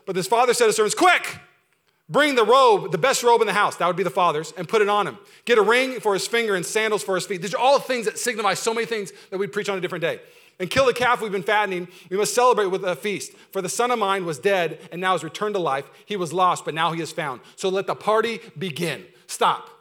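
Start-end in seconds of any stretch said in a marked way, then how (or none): 0.9-3.7 loud, urgent, higher call to servants; jubilant father. Acted. Father orders servants to celebrate. 4.5-7.4 loud, higher, eager orders; jubilant father. Acted. Father orders servants to celebrate. 10.6-19.1 loud father announcing a feast; jubilant proclamation. Acted.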